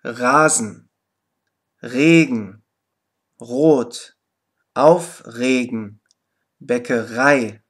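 „Rasen“ mit kräftigem Zäpfchen-R am Silbenanfang, langsam vorgesprochen; deutliche Aussprache. Shown as a demonstration. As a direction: jedes Wort deutlich mit R am Anfang vorsprechen. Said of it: "Zum Beispiel". (Beispielwörter zum Nachsprechen klar vorgesprochen)

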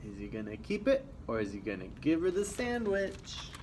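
usual speaking voice

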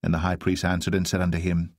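ordinary delivery

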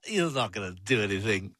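usual speaking voice

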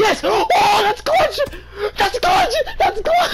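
high, thrilled yelling; excited shouting. Real, not acted. Teammate wins the round.

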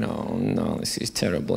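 low, soft, deflated groan; a soldier losing the war with Jim Bowen on stage, low and soft; mock despair. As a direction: sink into mock misery.

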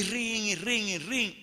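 repeated high doorbell rings; imitated doorbell. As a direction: mimic someone pressing the bell over and over. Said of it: "toqué el timbre unas cuantas veces".

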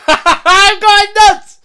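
high, loud manic cackle into words; crazed laughing. Acted. Relieved viewer playing at going nuts, manic laughing shout. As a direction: laugh madly and shout it, stretched out. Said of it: "I thought I was going crazy".

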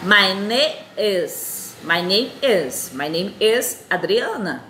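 My name is drawn out very slowly; slow, patient repeat-after-me delivery; slow teaching demo. Shown as a demonstration. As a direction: model my name is at a crawl for learners. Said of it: "Aí você responde".

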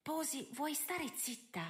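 hushed, high, slow plea; whispering. A narrator's reading. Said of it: "sussurrò Sophie".

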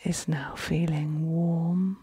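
soft, very slow meditation voice with long pauses; guided relaxation. Acted. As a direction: ease them into calm, hushed and unhurried.

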